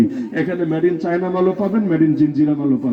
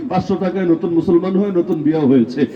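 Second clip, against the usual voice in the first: ordinary delivery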